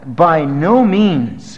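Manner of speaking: By no means, each word drawn out slowly; slow emphatic. Shown as a demonstration. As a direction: lean hard on "By no means". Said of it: "it means it's very emphatic".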